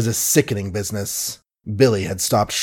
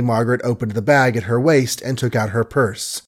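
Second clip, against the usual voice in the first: ordinary delivery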